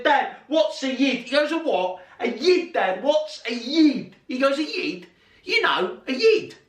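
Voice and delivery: retold child-and-dad exchange, voice pitched right up; high insistent questioning. Boy asks his dad at the match, high insistent questioning.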